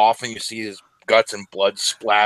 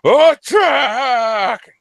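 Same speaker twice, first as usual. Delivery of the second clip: high, loud drawn-out war cry; long shouted order to charge; screamed battle cry; 13 semitones higher, 6 dB louder than usual. Acted.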